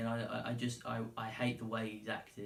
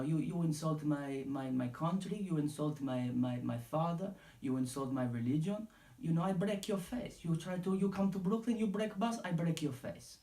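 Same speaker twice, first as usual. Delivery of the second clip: mock Dagestani accent, pitched a bit higher; broken-English Dagestani accent, getting higher toward the threat; Khabib impression; 5 semitones higher than usual. Podcaster paraphrasing Khabib's grievance in a mock Dagestani accent. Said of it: "but his basic point was".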